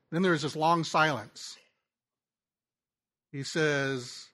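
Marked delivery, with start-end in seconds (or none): none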